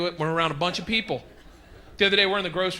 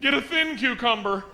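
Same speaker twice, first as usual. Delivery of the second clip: high, loud announcement; public holler; 8 semitones higher, 3 dB louder, slower than usual. Acted. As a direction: call it out so all the shoppers hear. Yelling to wife across the store.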